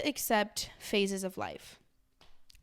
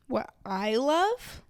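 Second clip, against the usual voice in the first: sarcastic; 5 dB louder, much slower than usual. Real, not acted. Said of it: "Can you guys tell when I'm being sarcastic?".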